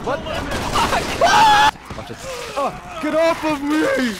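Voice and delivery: high loud startled shout; shocked yell. Real, not acted. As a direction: yell in sudden panic. Real shock during attack.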